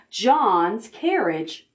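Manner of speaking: usual speaking voice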